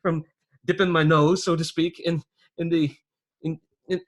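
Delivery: high, loud, stammering crook; wheedling underling. Acted. Phineas excuses his snuff habit to the boss, wheedling. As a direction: plead your excuse in a high voice, tripping over words.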